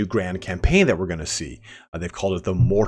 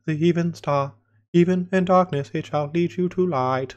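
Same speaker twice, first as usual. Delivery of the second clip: hammed-up airy Lord of the Rings elf, pitched way up; breathy elf; 9 semitones higher than usual. Streamer mocking Lord of the Rings elves, breathy and high. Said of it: "breathy Lord of the Rings elf".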